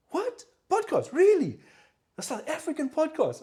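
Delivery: very high, incredulous woman's voice; imitated surprise. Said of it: "And she's like".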